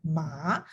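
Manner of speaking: "ma" rising from low; rising second tone. Shown as a demonstration. Teacher explaining the second word, rising "ma". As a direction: lift "ma" like a question. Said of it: "it can have different meanings".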